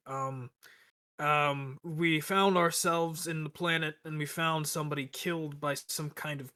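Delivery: usual speaking voice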